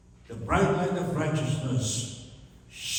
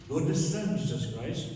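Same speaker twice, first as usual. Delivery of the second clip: ordinary talk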